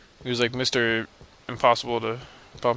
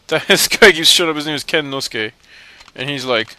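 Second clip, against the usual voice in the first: ordinary talk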